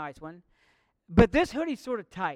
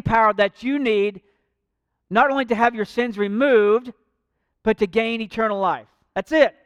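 louder fervent sermon voice; preaching; 4 dB louder than usual. Acted. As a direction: preach it to the congregation. Preaching altar call.

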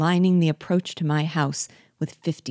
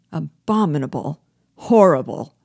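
outraged cry, much louder on Horrible; 6 dB louder than usual. A narrator's reading. Colonists crying out in horror at the proposal, loud. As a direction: shout it in horror. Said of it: "cried all".

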